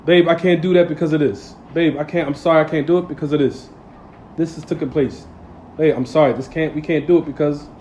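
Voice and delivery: soft, low, respectful pleading to husband; wife softly tells husband she can't. Acted. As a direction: gently explain like a humble wife.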